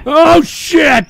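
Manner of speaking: high, loud startled cries; real startled high-pitched exclaiming; shocked shout. Real, not acted. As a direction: yell out in shock, voice leaping up. Startled again by the stream.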